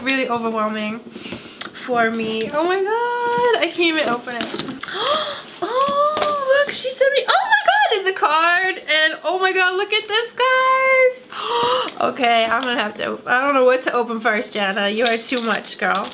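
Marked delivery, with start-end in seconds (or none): 4.8-12.0 very high, breathless gushing; excited squeal. Real, not acted. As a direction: squeal over every new thing you pull out. Opening a gift card, overwhelmed.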